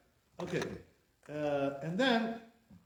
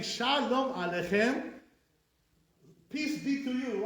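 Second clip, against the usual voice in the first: raised, stretched-out greeting; declaimed greeting; 6 semitones higher, slower than usual. Acted. Teacher greeting the angels, declaimed with raised pitch. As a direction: hail them slowly, voice lifted.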